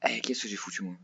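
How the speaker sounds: whispering. Real, not acted. Muttering to himself mid-game.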